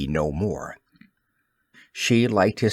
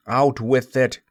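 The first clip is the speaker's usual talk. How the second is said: usual speaking voice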